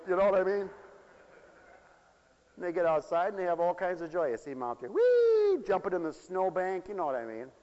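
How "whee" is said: high, loud, sustained squeal; gleeful child's whee. Retold or imitated. Kids jumping in the snowbank, gleeful squeal. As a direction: squeal like a kid leaping into snow.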